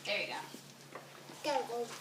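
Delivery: quietly redirect without stopping class; whispered aside. Real, not acted. Teacher hushing a fidgeting student, whispered.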